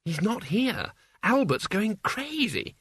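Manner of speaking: high, fretful character voice; worried girl. A narrator's reading. Isabel reporting Father missing, high and anxious. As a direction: fret in a high voice.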